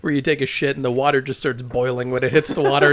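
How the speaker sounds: ordinary talk, nothing marked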